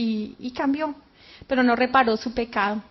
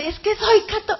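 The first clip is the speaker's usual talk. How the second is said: higher, louder cry for help; retold desperate cry; 6 semitones higher, 5 dB louder than usual. Woman in the pit pleading her faith, retold cry. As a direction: start shouting a plea, then cut off. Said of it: "empezó a gritar".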